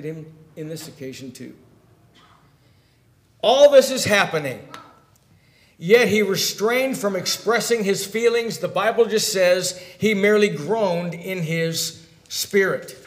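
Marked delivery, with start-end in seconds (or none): 3.4-13.0 raised, emphatic sermon delivery; forceful preaching. Acted. Preacher on Jesus holding back his feelings, forceful. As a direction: hit the key words like a preacher.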